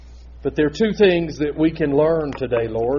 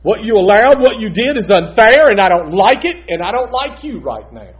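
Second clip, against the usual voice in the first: loud, high accusing prayer; mock-furious complaint at God; angry at God; 6 semitones higher, 10 dB louder than usual. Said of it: "I'm mad".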